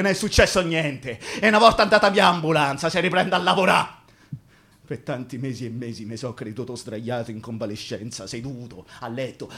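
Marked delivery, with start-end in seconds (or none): 4.9-9.5 low, very soft recollection; ricordo sommesso. Acted. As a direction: drop right down, low and hushed. Ricorda i mesi creduti di convalescenza. (ricordo sommesso, a voce bassa)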